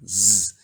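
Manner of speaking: a loud, clear "z"; single "z" sound said firmly; z sound demo. Shown as a demonstration. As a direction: sound out "z" on its own. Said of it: "For instance".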